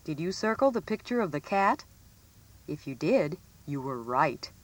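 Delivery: ordinary delivery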